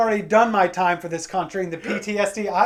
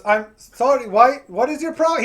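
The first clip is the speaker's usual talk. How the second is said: raised, higher mock Indian accent; 5 semitones higher, 4 dB louder than usual. Confused agent answering caller's apology, mock Indian accent. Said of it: "if I do the accent".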